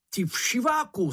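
high, mild storybook roar; roaring insult. A narrator's reading. Mumínek attacks the bush with the knife, high mild roar. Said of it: "zařval Mumínek".

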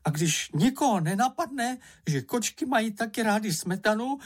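high-pitched, aggrieved little character; shouted tirade. A narrator's reading. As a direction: protest in a squeaky, offended voice. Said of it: "zakřičel".

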